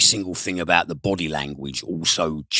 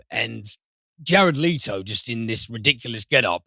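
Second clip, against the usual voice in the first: ordinary talk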